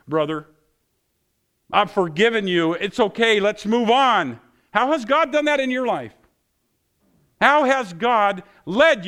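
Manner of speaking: loud, high, driving preacher's voice; full-force preaching. Acted. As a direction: preach it out hard to the congregation.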